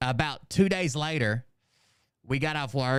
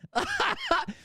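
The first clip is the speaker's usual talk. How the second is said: real squealing laugh, much higher; high burst of laughter. Real, not acted. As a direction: break up laughing. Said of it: "I was laughing so hard".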